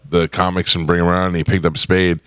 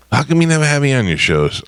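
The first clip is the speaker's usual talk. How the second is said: whiny complaint; 9 semitones higher than usual. Retold or imitated. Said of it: "he always goes".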